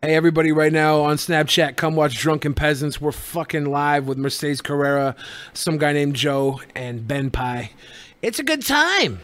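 normal talking voice

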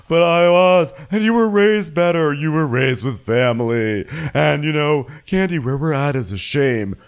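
high, loud hammed-up grievance; Marlo. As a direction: wail the complaint in mock self-pity. Mimics Marlo's aggrieved outburst.

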